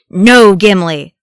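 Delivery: very loud, drawn-out shout. A narrator's reading. Thorin stops Gimli going back, shouting. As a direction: shout to stop him in his tracks. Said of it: "Thorin shouted".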